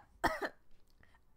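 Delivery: hacking coughs on tea; choking cough; coughing fit. Acted. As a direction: sputter and cough as tea goes down the wrong pipe. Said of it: "the tea goes down the wrong pipe".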